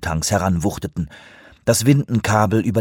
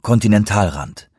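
ordinary delivery, nothing marked

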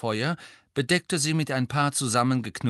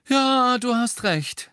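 breezy agreement; 13 semitones higher, 6 dB louder, slower than usual. A narrator's reading. George cheerfully agrees with angry Hermine.

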